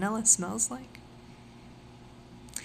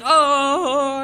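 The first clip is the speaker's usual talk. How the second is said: mock moan; 5 semitones higher, 9 dB louder than usual. Mock moan in crude fantasy.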